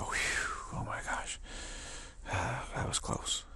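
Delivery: whispered relief, slow at the end; relieved whisper. Retold or imitated. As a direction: let the breath out after a near miss. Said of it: "and we go".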